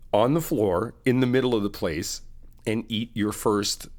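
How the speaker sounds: usual speaking voice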